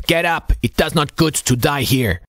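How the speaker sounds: rough, urgent call to a sailor; gruff voice calling. A narrator's reading. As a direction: call out like a gruff old petty officer. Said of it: "a gruff voice called from the dark".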